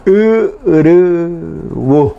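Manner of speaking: "Urubu" drawn out slowly with the guttural Guarani y vowel; slow "Urubu", stressing the guttural vowel. Shown as a demonstration. Teacher modelling the hard Guarani vowel in "Urubu", slowly. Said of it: "U, que es la Y".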